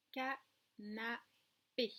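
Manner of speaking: very slow "canapé", cut in three; "canapé" split into separate syllables for pupils. Shown as a demonstration. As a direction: say ca... na... pé with pauses. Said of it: "Trois syllabes".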